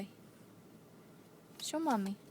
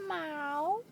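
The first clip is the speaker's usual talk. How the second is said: cat meow; 4 semitones higher than usual. Shown as a demonstration.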